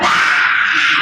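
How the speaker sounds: put-on high, loud childish shriek; toddler scream. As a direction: squeal like a delighted toddler. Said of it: "And I would scream".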